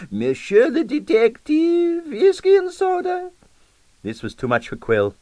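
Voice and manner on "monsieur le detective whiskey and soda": very high, slow gushing diva; grand hostess, Russian accent. A narrator's reading. Diva greets detective.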